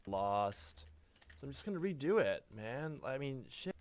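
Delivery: skater surfer voice. Acted. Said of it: "it's too skatery anyway".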